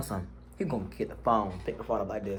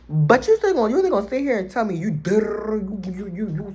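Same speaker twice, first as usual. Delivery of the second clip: outraged; 8 semitones higher, 5 dB louder than usual. Retold or imitated. Retold outraged confrontation.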